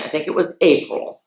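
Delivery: ordinary delivery, nothing marked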